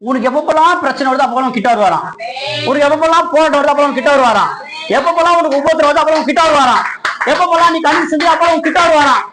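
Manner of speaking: high, loud, driving preacher's proclamation; fervent pulpit shouting in insistent, repeated waves; full-force preaching. Acted. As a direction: hammer out each promise at a high, sustained pitch.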